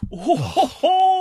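a high, laughing "ho" that breaks into a laugh; burst of laughter. Real, not acted.

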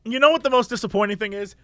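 ordinary talk

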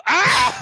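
high imitated cry of pain; mock zapped yelp. Mimicking the henchman getting zapped, a high mock yelp. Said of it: "that guy getting zapped".

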